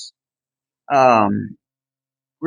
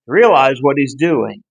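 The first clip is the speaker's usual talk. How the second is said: normal talking voice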